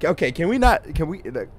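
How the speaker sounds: loud, high-pitched real alarm; startled. Real, not acted. Gamer spooked by a noise in the dark woods, startled. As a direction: jump with fright, voice shooting up. Scared by sound in game.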